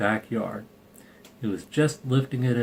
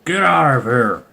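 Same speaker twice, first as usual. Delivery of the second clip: louder yell at the dogs; father shouting from the doorway; 2 semitones higher, 8 dB louder than usual. Acted. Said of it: "came to the door and shouted".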